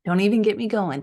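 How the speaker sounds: usual speaking voice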